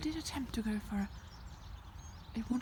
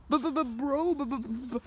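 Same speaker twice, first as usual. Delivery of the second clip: stammering bro; playful b-b-b stammer; mock stutter; 3 semitones higher, 6 dB louder, much slower than usual. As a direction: stutter it like you can't talk. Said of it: "I can't even speak".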